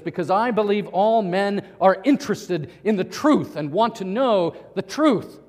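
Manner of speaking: loud, emphatic pronouncement; imitated forceful declaration. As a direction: hold forth like an assertive scientist. Retelling the scientist's forceful declaration.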